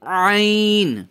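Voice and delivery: عین high and long, as if wringing it out; squeezed ain. Shown as a demonstration. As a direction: squeeze عین hard out of the throat. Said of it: "squeeze کر رہے ہیں".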